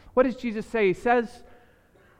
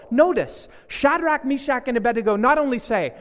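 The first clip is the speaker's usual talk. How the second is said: ordinary delivery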